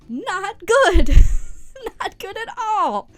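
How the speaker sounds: exclaimed. Real, not acted. Reacting to crash, laughing.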